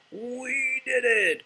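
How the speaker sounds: high, slow, triumphant Toad; excited cry. Acted. Toad cheering as the kite flies, high and thrilled. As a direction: crow with delight, high and drawn out. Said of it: "cried Toad".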